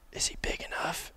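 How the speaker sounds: urgent hushed whisper. Retold or imitated. Hunter asks his cameraman about a close buck, whispered. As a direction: breathe the question, barely audible.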